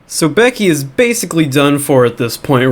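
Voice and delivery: ordinary talk, nothing marked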